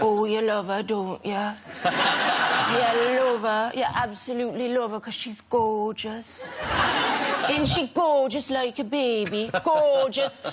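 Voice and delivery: mock Geordie gushing at a baby; Geordie accent. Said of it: "I can't do a Geordie accent".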